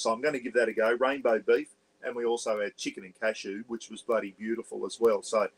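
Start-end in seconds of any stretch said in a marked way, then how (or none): none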